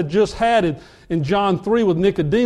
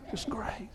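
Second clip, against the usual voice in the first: mock dismayed sigh; 2 semitones higher, 18 dB quieter than usual. Players dismayed at star kid.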